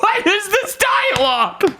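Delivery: high, laughing disbelief; laughing shout of disbelief; incredulous. Retold or imitated. Incredulous reaction while laughing.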